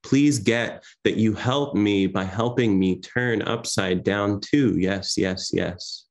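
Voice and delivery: measured, rhythmic recitation with long pauses; poem reading. Acted. Poet reading to an online audience, measured recitation.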